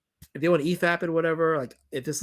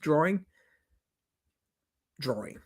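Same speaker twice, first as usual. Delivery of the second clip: New York accent; 4 dB quieter than usual. Shown as a demonstration. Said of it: "I have a New York accent".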